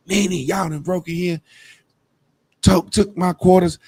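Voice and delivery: a hint of the aunt's tearful complaint; lightly mimicking a hurt aunt's tears; crying aunt. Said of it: "His aunt crying like a motherfucker".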